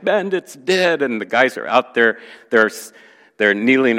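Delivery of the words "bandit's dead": high, slow mock wail; acted-out tearful, drawn-out cry; imitated crying. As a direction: wail it like a heartbroken man. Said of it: "he came running in crying".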